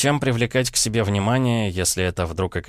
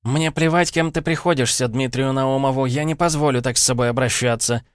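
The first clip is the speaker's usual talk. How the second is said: furious; 6 semitones higher than usual. A narrator's reading. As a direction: rage at him down the line. Furious secretary continues tirade.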